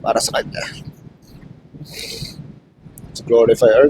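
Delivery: tearful, breaking whisper; crying while quoting the promise; choked up. Real, not acted. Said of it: "Pag naisip ko kasi, naiyak ako".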